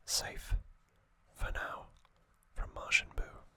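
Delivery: ordinary delivery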